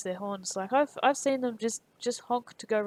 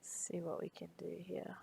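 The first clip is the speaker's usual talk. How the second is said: whisper; 2 semitones lower, 13 dB quieter than usual. Real, not acted. Whispering to herself while drawing.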